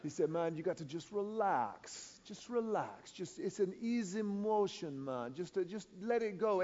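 relaxed island guide telling the pastor to loosen up; lightly voiced fishing guide. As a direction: coax the learner easily, with a chuckle. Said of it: "He was like".

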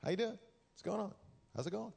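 lightly voiced high, friendly hello to drivers; cheery waving greeting. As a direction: play it like a man at peace waving. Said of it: "I'm like".

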